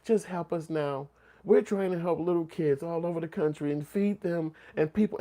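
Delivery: lightly mock the plea; imitating Sally Struthers. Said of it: "she would be like".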